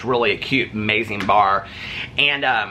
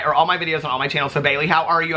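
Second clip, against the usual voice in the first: ordinary talk, nothing marked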